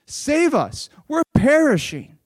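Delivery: high, acted desperate plea; crying out. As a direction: cry for help like frightened disciples. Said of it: "they cry out to him".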